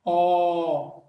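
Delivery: held open O, mouth closing slightly; slightly closed O. Shown as a demonstration. Teacher shows mouth closing a little, held O. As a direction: sustain O with slightly rounded mouth. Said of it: "o O fecha só um pouquinho".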